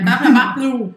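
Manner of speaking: loud mock-French babble; imitating Joey's gibberish. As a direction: babble nonsense like a bad French speaker. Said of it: "soltava som". (imitando o Joey falando sons sem sentido)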